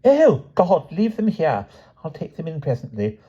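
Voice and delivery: put-on high, weary, disdainful posh girl; posh girl, bored and irritated; with dislike. A narrator's reading. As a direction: sigh it off in distaste. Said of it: "looking at them with dislike".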